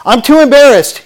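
high, loud imitated excuse; mock protest. Pastor voicing someone reluctant to come forward, mock protest. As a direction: blurt it out as someone else's objection.

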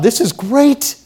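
high, pleased exclamation; high-pitched, self-assured glee; mock delight. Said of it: "Saul would have thought".

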